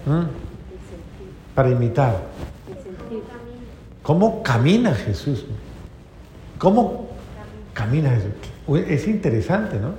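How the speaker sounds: normal talking voice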